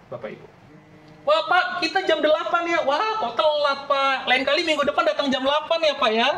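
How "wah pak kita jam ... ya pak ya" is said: high, mocking sing-song to a latecomer; sarcastic mocking. Preacher mocking a late churchgoer, sarcastic high sing-song. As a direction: needle him with sweet mock politeness.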